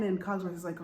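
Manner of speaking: ordinary delivery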